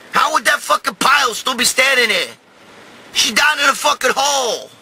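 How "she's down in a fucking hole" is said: normal talking voice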